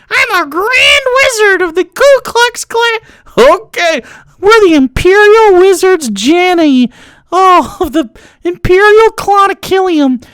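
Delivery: very high-pitched mocking nerd voice; mock grand wizard. Streamer mocking Klan role-players, squeaky nerd voice.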